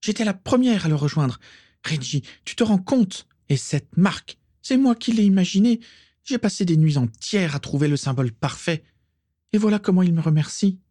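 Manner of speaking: high-pitched, aggrieved tirade; wounded pride boiling over; angry. A narrator's reading. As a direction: rant indignantly, voice held way up high. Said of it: "dit-elle avec colère".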